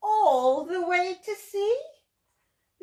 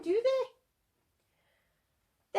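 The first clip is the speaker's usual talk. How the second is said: ordinary delivery, nothing marked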